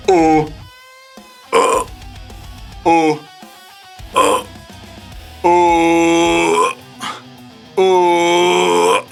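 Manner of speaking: low voice mixed with growl; deep rasping growl. Shown as a demonstration. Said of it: "using a low voice".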